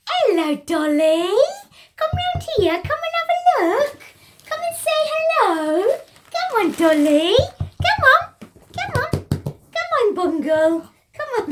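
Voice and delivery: high, loud sing-song coaxing; pet voice. Real, not acted. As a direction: call the pets over sweetly. Coaxing her pet birds over.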